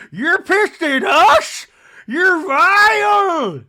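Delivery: slow, high-pitched screaming outrage, hammed up; Meredith. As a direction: shriek it mockingly, dragging out every word. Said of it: "poke the Meredith bear".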